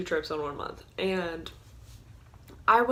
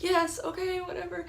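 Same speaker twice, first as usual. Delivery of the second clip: high, meek little yes okay; mock timid compliance; 11 semitones higher than usual. To the robber, mock frightened high agreement. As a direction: play the scared victim going along. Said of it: "responding with fear".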